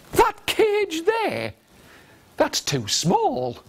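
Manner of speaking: slow, starting very high; disbelieving character. Acted. Beggar challenges the tiger about the cage, slow disbelief. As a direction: stretch it out, high in disbelief then drop.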